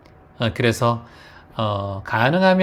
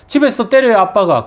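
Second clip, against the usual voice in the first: put-on much higher and louder, a kid blurting out; child's plea; 10 semitones higher, 9 dB louder than usual. Boy tells the passerby his father hits him at home, high and loud. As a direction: cry out like a child telling on his dad.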